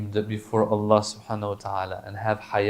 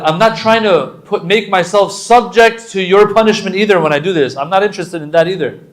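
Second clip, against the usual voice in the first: passionate preaching; 7 semitones higher, 10 dB louder than usual. Real, not acted.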